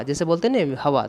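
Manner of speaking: ordinary talk, nothing marked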